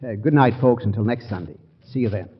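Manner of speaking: normal talking voice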